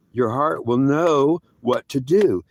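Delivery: very slow, measured voice of God; deliberate, drawn-out pronouncement; slow, weighty repeat. Retold or imitated. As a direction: spell it out slowly, word by word.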